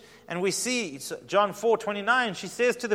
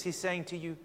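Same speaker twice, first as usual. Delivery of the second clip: normal talking voice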